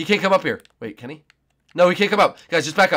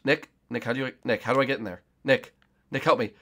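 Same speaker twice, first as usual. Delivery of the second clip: ordinary delivery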